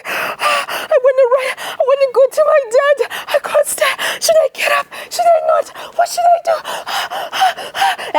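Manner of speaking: put-on a frightened child pinned under a train; terrified boy. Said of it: "he's breathing so fast".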